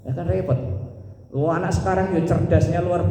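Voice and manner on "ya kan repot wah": normal talking voice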